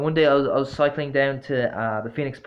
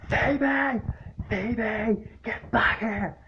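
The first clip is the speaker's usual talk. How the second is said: high mock scream of the old man's call; a higher, lightly imitated old man calling his dog; 9 semitones higher, much slower than usual. Old man screaming after his greyhound, mock high yell. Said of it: "screaming as loud as he could".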